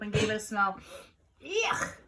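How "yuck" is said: disgusted. A narrator's reading. Character smells smelly soap.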